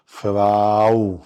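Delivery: فراو drawn out slowly as fraw; slow model pronunciation. Shown as a demonstration. Teacher telling the kids what to call the lady teacher, slow. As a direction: stretch فراو for the class. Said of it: "انطق معايا".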